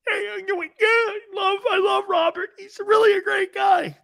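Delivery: high-pitched blubbering impression; mock crying. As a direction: wail it in a squeaky, hammed-up sob. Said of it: "Why do you think Roquan Smith's crying?".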